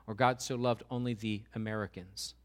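ordinary delivery

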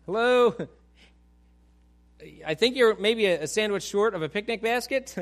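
loud, mocking "hello?" with a laugh in it; sarcastic retort. Samaritan woman sassing Jesus at the well, loud sarcasm. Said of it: "kinda throwing back sarcasm".

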